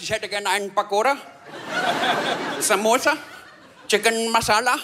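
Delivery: exaggerated imitation of an Indian accent, item by item; mirrored Indian accent. Customer unwittingly mirroring the man on the phone, Indian accent. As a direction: order food in a put-on Indian accent. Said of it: "diesen Akzent oder Dialekt direkt zu spiegeln".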